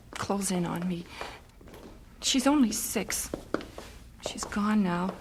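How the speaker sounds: hushed, broken whisper; grieving whisper. Acted. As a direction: whisper it as if the walls are closing in. Grieving mother, sent child away.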